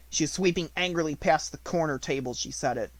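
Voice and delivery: usual speaking voice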